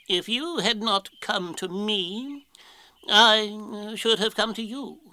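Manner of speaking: slow, high storybook Owl; drawn-out, hesitant owl voice; ponderous Owl, bluffing. Acted. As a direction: stall pompously as a would-be wise owl.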